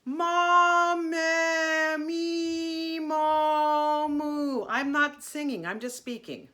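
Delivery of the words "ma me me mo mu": vowels held long in one steady placed tone; speak-sing the vowels, placement fixed behind the nose; sustained vowel drill. Shown as a demonstration. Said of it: "let's just try going".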